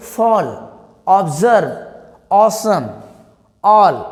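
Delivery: Fall, observe, awesome, all with a rounded 'aw', each separate; word-by-word model of 'aw' in Fall, observe, awesome, all।; rounded 'aw' sound. Shown as a demonstration. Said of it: "और यहाँ क्या पढ़ेंगे?". (Fall, observe, awesome, all। में गोल ऑ)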